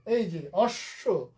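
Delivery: অশ্ব said slowly as ossho, double sh and final o; slow careful অশ্ব. Shown as a demonstration. Teacher shows students how অশ্ব sounds, slow and careful. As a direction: drag out এই যে অশ্ব for students to copy. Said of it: "এই বফলার মধ্যে কিন্তু একটা অ আছে".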